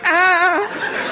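high-pitched reenacted shriek of alarm; startled scream. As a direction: yelp as if startled by someone dreaded. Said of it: "I think I visibly was like".

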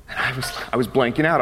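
ordinary delivery

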